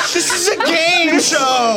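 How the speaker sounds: high, slow laughing announcement. Real, not acted. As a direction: squeal it through laughter.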